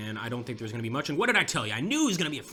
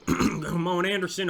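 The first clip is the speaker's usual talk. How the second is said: urging call; 5 semitones higher, 5 dB louder, slower than usual. Real, not acted. Urging troops on in battle.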